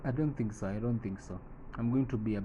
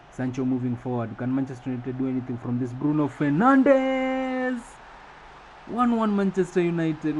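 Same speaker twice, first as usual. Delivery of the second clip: excited play-by-play; 4 semitones higher, 6 dB louder than usual. Acted. Commentator calls Bruno Fernandes's equaliser, excited play-by-play. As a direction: build to a long, high shouted scorer's name, then ease down.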